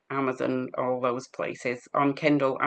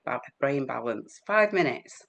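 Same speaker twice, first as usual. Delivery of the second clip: ordinary talk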